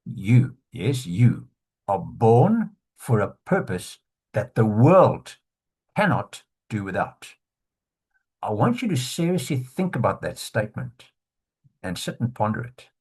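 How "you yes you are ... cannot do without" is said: slow, weighty stress on "You", "born" and "world"; deliberate emphasis. Shown as a demonstration. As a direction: punch "You", "born", "world", pausing between phrases. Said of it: "I'll put it on the right syllable for you".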